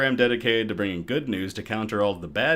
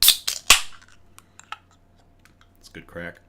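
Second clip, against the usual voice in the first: ordinary talk, nothing marked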